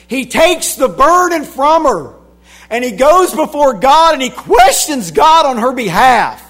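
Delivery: loud, high building shout; fiery preaching crescendo; preaching at full force. Acted. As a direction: drive it up to a climax from the pulpit.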